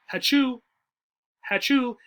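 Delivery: hammed-up sneeze on hachoo; sneeze sound. Shown as a demonstration. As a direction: fake a quick sneeze. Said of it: "say it faster".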